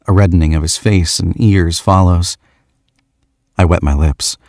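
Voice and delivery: ordinary delivery, nothing marked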